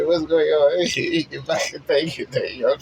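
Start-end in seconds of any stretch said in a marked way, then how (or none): 0.0-0.9 awed wonder. Retold or imitated. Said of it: "sometimes, eh".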